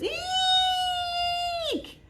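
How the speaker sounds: very high squeal; frightened shriek. A narrator's reading. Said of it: "sister goes".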